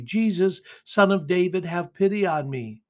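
a measured cry from a scripture reader; mild cry for pity. A narrator's reading. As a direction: call out for mercy, fairly restrained. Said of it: "he began to cry out and say".

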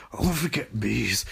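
soft, sunken repeat; subdued mock distress. Barry just revived after bees, quietly subdued. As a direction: let it drop to a weak murmur.

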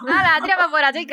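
shooing her out, playfully; bossy guard shooing voice; mock guard shove. Joins acting out the guards.

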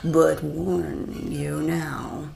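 slow, ominous genie voice. Acted. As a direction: lay down the warning slowly. Genie speaks slowly, ominously.

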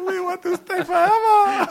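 high, slow Korean-accent imitation through laughter; laughing, squeaky mock Korean accent. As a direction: echo the bad Korean accent while cracking up. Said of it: "Sorry for the bad accent".

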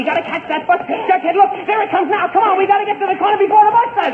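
excited running chatter; breathless hurry. Acted. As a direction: hurry him on while running for the bus. Racing to catch the bus.

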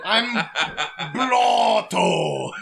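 mock Fargo accent cartoon voice; Blotto. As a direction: introduce yourself as a silly Fargo-accented cousin. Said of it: "I'm Watto's cousin".

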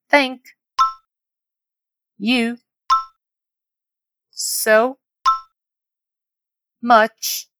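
"Thank", "you", "so", "much", "for", "your", "patience", "Bye" each alone; word by word. Shown as a demonstration. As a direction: leave long gaps between the words of "Thank you so much for your patience". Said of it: "Vamos repetir mais uma vez?".

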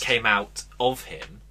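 drawn-out, deliberate punchline; slow emphatic repeat. Shown as a demonstration. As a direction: lean on each word slowly. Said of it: "he said it again".

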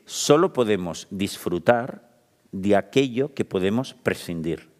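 lento y marcado, palabra por palabra; slow quoted maxim. Shown as a demonstration. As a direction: cita la frase despacio, con peso. Esta es un poco difícil. (leyendo una máxima con calma deliberada)

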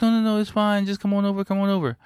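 chirpy pushy lady insisting he come; high mock lady voice waving off his doubts; imitating the eager lady. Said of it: "she's like".

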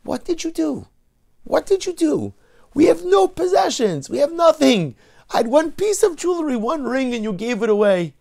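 high, loud furious screech at her husband; yelling wife. Acted. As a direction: scream it as the robbed wife. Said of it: "She starts yelling at her husband".

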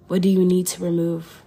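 ordinary delivery

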